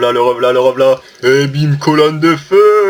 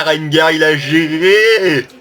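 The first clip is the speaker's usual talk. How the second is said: ordinary talk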